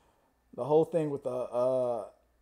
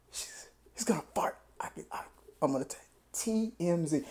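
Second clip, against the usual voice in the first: hushed lurker; 9 dB quieter than usual. Acted. Sound man to himself while eavesdropping, hushed whisper. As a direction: whisper it like a sneak listening in. Mimics microphone man stalking him.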